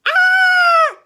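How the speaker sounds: piercing high scream; loud, very high screech; baby bear screech. A narrator's reading. Baby Bear finds the chair, furious little screech. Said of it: "Baby Bear screeched".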